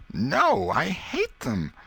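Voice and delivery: put-on a prim little girl wrinkling her nose; girl's voice. A narrator's reading. Becky to Tom about rats, high and disgusted.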